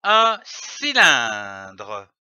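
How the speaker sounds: teacher naming the object, slow; slow, low naming. Shown as a demonstration. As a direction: stretch out un cylindre slowly and low.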